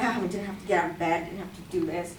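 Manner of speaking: low, quick grumble to oneself; retold grumpy grumble. Speaker grumbling about leaving bed on a holiday, grumpy. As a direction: grumble it softly and fast under your breath. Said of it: "I'm all grumpy".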